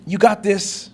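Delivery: mock hype. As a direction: imitate an over-keen motivator cheering someone on. Mimicking unwanted cheerleading motivation.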